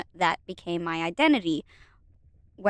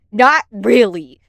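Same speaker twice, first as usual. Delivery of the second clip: sarcastic; 11 dB louder, slower than usual. Pretend. Sarcastic aside.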